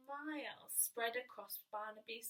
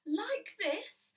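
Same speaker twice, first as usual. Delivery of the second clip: a little out of breath, higher and louder; puffing; 7 semitones higher, 7 dB louder than usual. Acted. Bear puffing as he runs past George. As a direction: puff it out mid-run. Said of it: "he puffed".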